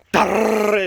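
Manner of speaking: rolled, rapid-fire "darrr"; machine gun noise. Retold or imitated. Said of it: "It sounded like a machine gun".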